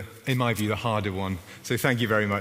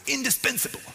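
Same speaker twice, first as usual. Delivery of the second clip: mock American shout, pitched way up; American accent shout; 14 semitones higher than usual. Pastor mimicking his Old Testament lecturer, mock shout in American accent. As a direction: imitate an American lecturer's high-pitched exclamation. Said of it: "He shouted it in his American accent".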